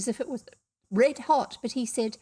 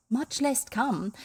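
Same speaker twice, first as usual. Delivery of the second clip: usual speaking voice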